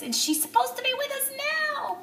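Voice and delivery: the words squeeze out through tears; voice breaking. Real, not acted. Telling viewers the nurse should be with them, voice breaking. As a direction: pitch jumps way up as the voice cracks.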